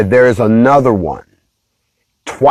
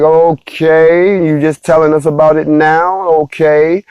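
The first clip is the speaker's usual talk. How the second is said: sarcastic okay; 6 semitones higher, slower than usual. Retold or imitated. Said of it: "And so we're like".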